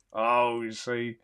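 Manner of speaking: normal talking voice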